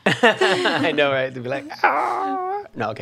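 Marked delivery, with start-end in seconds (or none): 1.8-2.6 high-pitched mock scream. Co-host imitates listeners reacting to a scream, mock shriek. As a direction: squeal a pretend scream, without much force. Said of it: "if I scream".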